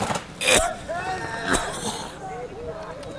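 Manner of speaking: hard, high coughing fit. Real, not acted. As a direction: cough up the swallowed bug. Swallowed bug, coughing fit.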